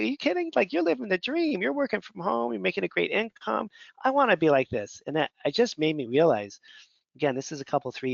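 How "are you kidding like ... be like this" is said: much higher, eager disbelief; amazed friend. Retold or imitated. Friend telling Dan he is living the dream, high excited disbelief. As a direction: gush like a friend who can't believe your luck.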